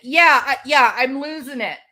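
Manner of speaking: ordinary talk, nothing marked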